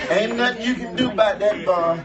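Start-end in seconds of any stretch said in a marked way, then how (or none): none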